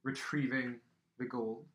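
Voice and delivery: loud, measured commander; forceful game-master villain; sceptical captor. Acted. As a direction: lay down terms as the captor, with pauses.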